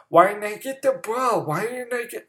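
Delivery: a sulking star player, mocked as a whiny baby; whiny crybaby. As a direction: whine like a spoiled kid who wants the ball. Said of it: "he'd still be bitching like a little fucking baby".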